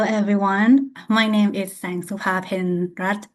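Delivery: ordinary talk, nothing marked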